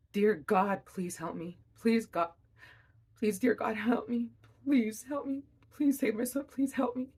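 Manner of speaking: retold panicked pleading, rising high; desperate screaming prayer. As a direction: beg God for help, voice climbing in panic. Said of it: "And I'm just screaming".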